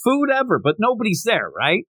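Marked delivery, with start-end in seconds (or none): 0.0-0.6 mock yell. Customer shouting praise to the empty street, high mock yell. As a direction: call it out like a thrilled customer. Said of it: "yell out".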